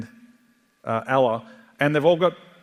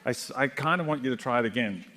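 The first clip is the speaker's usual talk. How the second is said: ordinary talk, nothing marked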